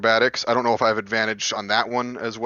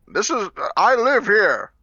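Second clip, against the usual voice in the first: yelling; 11 semitones higher, 6 dB louder, slower than usual. Retold or imitated. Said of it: "I yell out".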